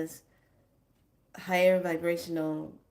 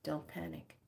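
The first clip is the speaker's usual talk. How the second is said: ordinary talk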